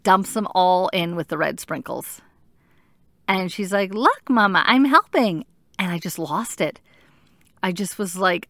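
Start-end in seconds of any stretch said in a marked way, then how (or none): 3.9-5.5 high, louder mimicked three-year-old; eager little daughter. Daughter tells mom she is helping with sprinkles, eager child voice. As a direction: imitate a child proudly chirping up to Mama. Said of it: "my daughter, three-year-old, you know, wants to help".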